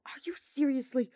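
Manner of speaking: incredulous whisper. Acted. Hubert exasperated at Sylvain.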